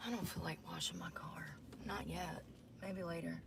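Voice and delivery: hushed whisper, barely voiced. Real, not acted. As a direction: whisper so nobody outside hears. Hiding from men nearby.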